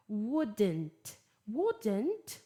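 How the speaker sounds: "wouldn't" British-style, T clearly sounded; careful British "wouldn't", T released; British pronunciation. Shown as a demonstration. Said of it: "Tô dando as pronúncias British e American".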